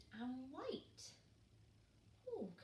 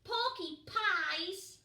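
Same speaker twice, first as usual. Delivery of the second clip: higher, louder, very slow; cheeky little penguin; 8 semitones higher, 8 dB louder, much slower than usual. Acted. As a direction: drag out the silly answer. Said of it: "said Pog".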